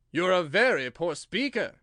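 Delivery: a king scolding his subject; loud reproving royal voice; stern king. A narrator's reading.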